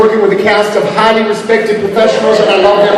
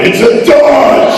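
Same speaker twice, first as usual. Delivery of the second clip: louder, higher yell on Dodge; 8 semitones higher, 5 dB louder, slower than usual. Retold or imitated. Fans ask the actor to yell the catchphrase, a punchy yell. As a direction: shout it like the abridged gag. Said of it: "asking me to yell Dodge".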